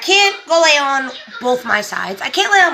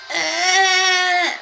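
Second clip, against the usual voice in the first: high, held mock baby wail; imitated newborn cry; 8 semitones higher than usual. Mother imitating her baby's first cry, high wail. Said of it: "and I heard it's crying".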